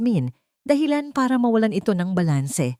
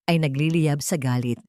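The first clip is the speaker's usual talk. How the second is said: plain reading voice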